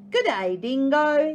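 high, loud cheery greeting; bright call from the bank; platypus voice. Acted.

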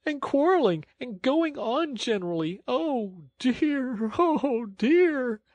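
very high, hammed-up tearful wail; sobbing. A narrator's reading. Toad as washerwoman fretting over children, sobbing lament. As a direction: pile up the woes in a piteous high voice. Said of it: "sobbed Toad".

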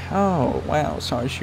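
ordinary talk, nothing marked

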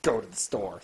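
dropped low, mocking advice; a low, mocking aside; mock deep voice. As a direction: sneer it in a low put-on voice.

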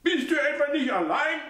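put-on high boring voice of the old mother; probing mother. A narrator's reading. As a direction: bore into him with suspicion. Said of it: "mit bohrender Stimme".

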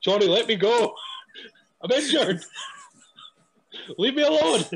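giggling shove-off of a clingy friend; laughing protest. Retold or imitated. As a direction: laugh through a mock brush-off. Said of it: "I'm like".

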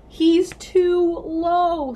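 high, loud, stretched-out warning; alarmed call. Acted. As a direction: call out in alarm, dragging each word. Alarm at glider flying low.

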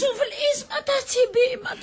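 high-pitched agonised whine; feigned pain wail. Acted. Wife faking an attack before husband, wailing. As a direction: cry out as if being pricked.

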